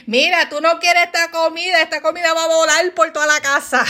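high, mock-furious threat; comic blow-up, quickening to a laugh; fired up. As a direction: go from zero to a thousand, hammed up. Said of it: "yo me enciendo de u-, de uno a mil súper rápido".

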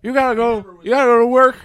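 put-on high, loud old-man lecture about going to work; Joe Biden impression. Mock Biden telling a broke listener to get to work, high and loud. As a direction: wag a finger like a cranky old president. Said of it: "this is my Biden".

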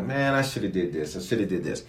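soft, regretful murmur; muttered self-reproach; quiet guilty regret. Retold or imitated.

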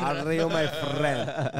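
slow, loud Indian English drawl; mock Indian accent. Friends mocking immigrant English, slow loud Indian accent. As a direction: stretch it out like a friendly Indian clerk. Imitating Indian speaker, drawn out.

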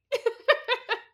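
loud, high burst of laughter; high real giggle fit; giddy laugh. Real, not acted. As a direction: burst out laughing, embarrassed at the gushing. Laughs after calling voice sexy.

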